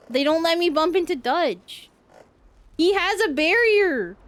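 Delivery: high, loud outraged complaint; loud indignant protest. Real, not acted. Player blocked from bumping a character, loud indignant protest. Protests being unable to bump Dutch.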